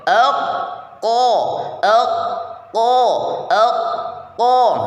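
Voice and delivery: ek ko dengan lidah belakang menempel rapat; pelafalan qaf. Shown as a demonstration. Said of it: "tempelkan rapat-rapat lidah bagian belakang". (ek ko dengan lidah ditempel rapat)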